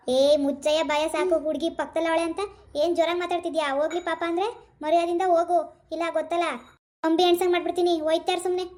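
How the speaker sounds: angry police threat. Acted. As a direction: threaten him into silence. Policeman silences and threatens the young man.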